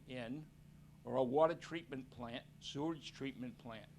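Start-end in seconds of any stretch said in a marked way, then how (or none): none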